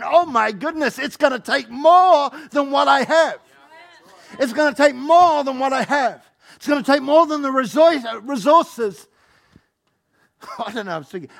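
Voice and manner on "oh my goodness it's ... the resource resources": higher, louder, slow panicked cry; the believer overwhelmed by the task, shouting. Acted. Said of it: "you're going".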